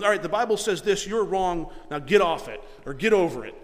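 loud, curt rebuke; harsh dismissive rebuke. Retold or imitated. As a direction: brush them off with the rule. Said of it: "That's not long suffering".

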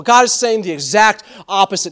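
ordinary talk

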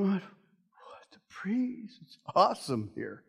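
half-whispered scoffing, dropping to a low murmur; mock dismissive mutter. Sailors scoffing at Paul's warning, muttered mock dismissal. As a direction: scoff at the preacher under your breath.

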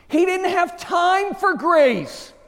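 high, slow, ringing proclamation; emphatic preaching. Real, not acted. Preacher to congregation on the thief, emphatic preaching. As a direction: stretch it out from the pulpit. Preacher drives the point home.

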